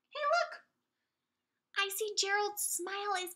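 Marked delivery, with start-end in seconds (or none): 0.1-0.6 much higher, bright call; delighted "hey, look" to the children; excited exclamation. Acted. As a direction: point it out with delight. Excited discovery for preschoolers.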